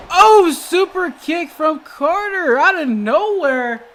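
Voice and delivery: high, very loud, slow drawn-out call; explosive wrestling commentator call; excited shout. Real, not acted. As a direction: shout the shock hit, stretching it out. Calls a surprise super kick.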